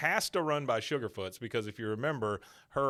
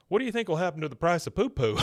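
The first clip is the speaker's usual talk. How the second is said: dopey question; 6 semitones higher, 6 dB louder, faster than usual. Retold or imitated. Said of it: "And then Bill".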